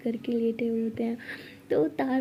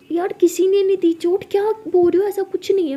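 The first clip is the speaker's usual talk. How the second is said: loud, high-pitched protest; acted defensive outburst, much higher and louder; agitated denial; 7 semitones higher, 6 dB louder than usual. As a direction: deny it hotly, voice raised. Tara hotly denies being hurt.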